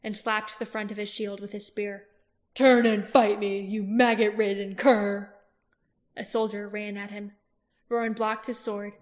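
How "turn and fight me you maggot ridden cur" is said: loud, slow insulting challenge; taunting shout. Acted. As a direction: goad the foe into a duel. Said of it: "he shouted again".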